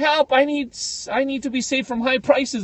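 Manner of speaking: hammed-up damsel in distress; mock distress call. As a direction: play a damsel calling for rescue. Mock ad voice for Uber.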